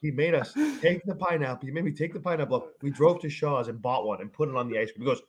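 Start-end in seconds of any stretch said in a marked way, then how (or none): none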